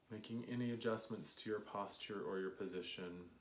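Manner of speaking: calm them into the breath; guided meditation. Acted.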